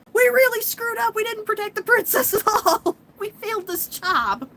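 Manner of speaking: high, loud, hammed-up lament; wailing guardians. Inner guardians mourn failing the princess, high and loud wail. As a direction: wail over the failure. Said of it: "the inner guardians are like".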